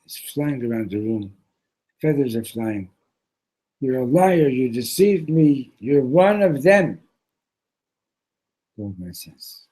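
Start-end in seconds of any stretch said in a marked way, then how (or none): 3.8-7.0 louder, slower angry accusation; upset accusation. Acted. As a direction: accuse him, outraged and deliberate. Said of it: "He gets so upset".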